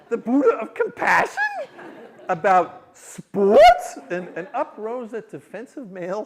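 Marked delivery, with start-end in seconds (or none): 0.1-4.0 loud incredulity, drawing out "sports"; hammed-up outraged disbelief; appalled wife. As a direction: build to a stretched, scandalized question. Wife's mounting disbelief.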